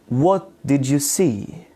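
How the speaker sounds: slow question with "see" sliding down; falling intonation. Shown as a demonstration. Teacher repeats the W H example slowly, falling intonation. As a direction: draw it out and drop the pitch on "see". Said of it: "W H questions are falling intonation".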